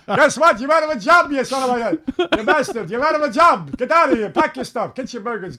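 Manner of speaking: high-pitched mock firing rant; annoyed owner impression. Boss fires the kid mimicking him, high ranting voice. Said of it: "And I would imitate him".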